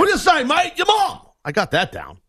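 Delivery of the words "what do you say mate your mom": high, hammed-up English accent, much higher; a jokey cockney-style English mate voice, pitched way up; mock English accent. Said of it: "I got my English accent down".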